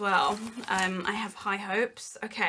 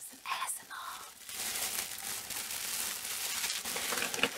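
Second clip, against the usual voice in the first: very soft, hushed whisper; ASMR whisper; 11 dB quieter, much faster than usual. Shown as a demonstration. Vlogger surprising viewers with some ASMR, whispered. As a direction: whisper it close to the microphone. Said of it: "bet you weren't expecting A S M R".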